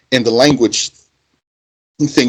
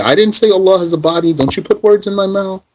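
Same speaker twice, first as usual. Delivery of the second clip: higher, fast mock protest; indignant opponent; 6 semitones higher, faster than usual. Mimics the opponent denying the charge, higher, quick and indignant. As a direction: act out his huffy denial. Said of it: "And he's gonna tell you".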